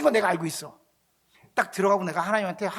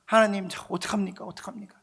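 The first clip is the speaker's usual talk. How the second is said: normal talking voice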